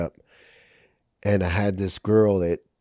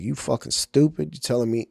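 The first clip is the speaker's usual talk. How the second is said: insulted retort; 6 semitones higher than usual. Retold or imitated. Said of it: "so disrespected and offended".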